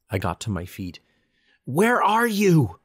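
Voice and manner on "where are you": slow, high, loud desperate scream. A narrator's reading. Calling to the reapers who never came, desperate scream. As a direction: scream it across the graveyard. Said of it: "I screamed".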